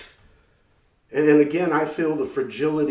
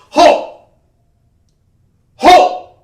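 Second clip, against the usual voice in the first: barked commands, much higher and louder; cop yelling halt; 15 dB louder than usual. Retold or imitated. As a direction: shout it like a jumpy cop with a gun drawn.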